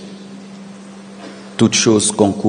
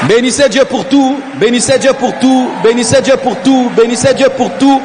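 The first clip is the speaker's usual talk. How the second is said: preaching at full force; 10 semitones higher than usual. Acted.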